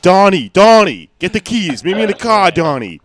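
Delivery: loud, high Boston-style shout; calling out. Pretend. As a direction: yell across the driveway for Donnie. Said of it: "It's just".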